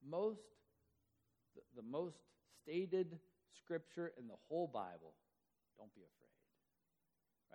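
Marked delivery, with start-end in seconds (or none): 5.8-7.5 slow, soft unvoiced whisper. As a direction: breathe it gently. Angel's gentle words.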